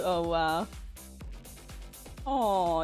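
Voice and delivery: usual speaking voice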